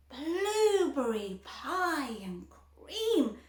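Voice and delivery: slow, savoured shout. A narrator's reading. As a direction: relish every word. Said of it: "shouted Violet".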